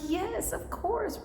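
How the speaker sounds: high, slow, thrilled yes; squealing yes, of course; delighted. Retold or imitated. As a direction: gush with delight. Eager reply about real syrup.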